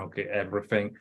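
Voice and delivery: normal talking voice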